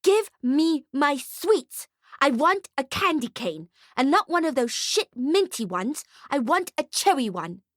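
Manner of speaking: put-on high, insistent child tantrum; high-pitched petulant brat; bratty little girl, demanding. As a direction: demand sweets like a spoilt kid. Voices the girl's tantrum.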